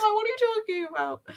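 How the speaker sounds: very high, squeaky-pitched clueless act; mock bafflement. Friend plays along with the elves joke, mock baffled.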